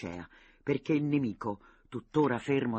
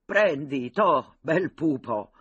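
louder, slow mocking jeer; mock the enemy with each throw; taunting; 4 semitones higher, 9 dB louder, much slower than usual. A narrator's reading. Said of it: "parole di irrisione". (derisione lenta e più forte)

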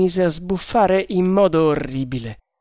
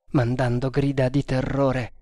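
ordinary delivery

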